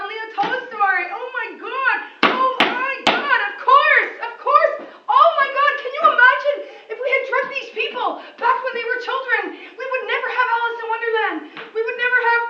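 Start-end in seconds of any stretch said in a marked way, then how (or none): 1.1-5.7 high, loud excited exclamations. Real, not acted. Vlogger gushing to viewers, high and loud with excitement. 5.8-12.4 high, loud and laughing; excited. Real, not acted. Vlogger ranting to viewers about drugging geniuses, excited and loud.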